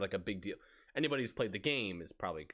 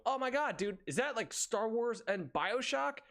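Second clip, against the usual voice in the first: higher, thrilled fan voice; excited discovery; 12 semitones higher than usual. Retold or imitated. Coworker imagining a stranger getting the reference, thrilled. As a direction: gush as if you just cracked the code.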